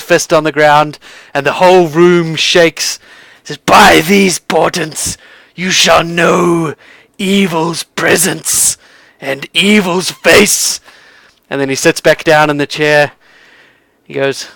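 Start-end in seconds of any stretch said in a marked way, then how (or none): 3.6-10.8 very slow, portentous proclamation; solemn prophecy. Acted. Seer pronounces evil's presence to his visitors, slow and portentous. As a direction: draw out every word like a dire omen.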